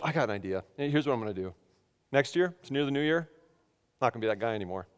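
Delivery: low, soft private musing; hushed, low inner voice; thinking to himself. Retold or imitated. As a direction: murmur a quiet resolve to yourself. Retells thinking to himself, resolving.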